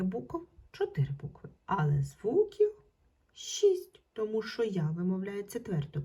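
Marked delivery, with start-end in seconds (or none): none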